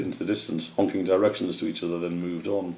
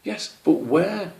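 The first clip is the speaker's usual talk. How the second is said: insistent hedgehog; 11 semitones higher, slower than usual. Acted.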